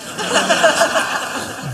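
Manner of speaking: short amused chuckle; laughs at own joke. Real, not acted. Preacher laughing at his own joke about his preaching.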